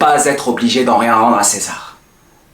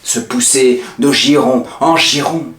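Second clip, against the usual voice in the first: ordinary talk, nothing marked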